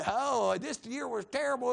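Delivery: hammed-up complaint about a bad year; mock lament. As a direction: fake moaning over troubles. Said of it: "that was faking".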